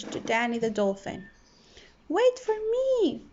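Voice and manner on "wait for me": high, small voice calling after friends; a tiny fish pleading to wait; little fish crying out. A narrator's reading. Little fish calls after his friends, high and small. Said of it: "cried little fish".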